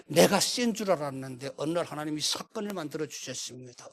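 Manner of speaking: usual speaking voice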